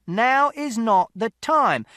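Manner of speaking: high, loud, slow, firm; shouted warning. A narrator's reading. Mister Shears to the quarrelling parents, shouted warning. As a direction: lay down the law at full volume. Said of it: "And Mister Shears shouted".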